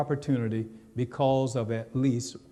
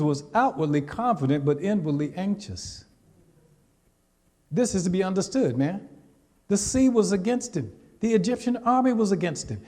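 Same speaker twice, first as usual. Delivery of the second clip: ordinary delivery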